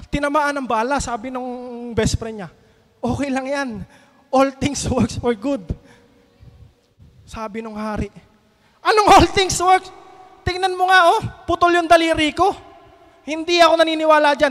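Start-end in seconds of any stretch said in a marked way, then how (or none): 3.0-5.6 calm reassurance. Acted. Friend calmly reassures the king. 8.8-14.5 loud, higher outraged king; the king furious at losing his finger, shouting back; angry king. Acted. King berates his best friend, loud and angry.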